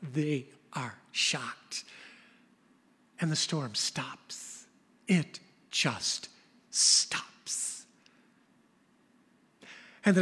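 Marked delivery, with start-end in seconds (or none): 0.0-7.8 hushed, slow and breathy; awed whisper. A narrator's reading. Preacher telling how the storm suddenly stops, in an awed whisper. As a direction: let the silence fall as you whisper.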